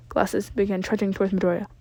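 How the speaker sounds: plain reading voice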